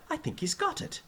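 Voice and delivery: very high, amazed cheer. A narrator's reading. Teacher delighted by his pupil, high cheer. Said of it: "Higgins cheered".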